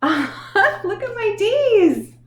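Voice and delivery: giggling and slow; laughing. Real, not acted. Teacher laughs with pride, showing the kids her letters. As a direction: laugh proudly as you show them.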